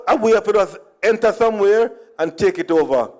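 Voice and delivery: usual speaking voice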